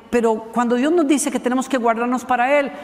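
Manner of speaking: ordinary talk, nothing marked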